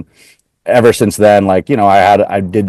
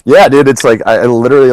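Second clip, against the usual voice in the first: high, loud cocky agreement; 4 semitones higher, 4 dB louder than usual. Retold or imitated. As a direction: own it with swagger. Cocky reply to friends.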